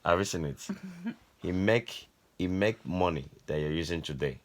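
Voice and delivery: ordinary delivery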